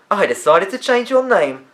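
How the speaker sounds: put-on a lively girl greeting her two friends, high and loud; lively girl's voice. A narrator's reading. Olivia explains.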